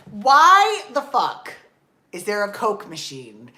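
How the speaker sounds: slow, loud disbelieving outburst; outraged yell. Real, not acted. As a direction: shout it in total disbelief. Outraged at the routine.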